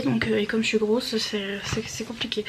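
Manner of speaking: fading, breathless voice; running out of air mid-sentence; out of breath. Real, not acted. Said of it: "Je suis essoufflée". (à bout de souffle)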